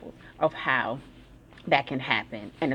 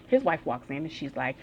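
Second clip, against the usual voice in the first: ordinary talk, nothing marked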